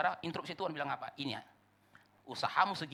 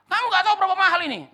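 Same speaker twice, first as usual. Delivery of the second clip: fast, high, loud outraged yell; furious; 17 dB louder, faster than usual. Acted. House owner berating painter about costly painting, furious. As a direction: rant at full volume, acting enraged. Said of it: "orang yang punya rumah ini marah besar".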